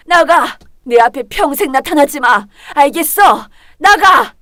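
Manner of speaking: loud commanding yell; angry shout. Acted. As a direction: drive her out of the house. Mother orders daughter out.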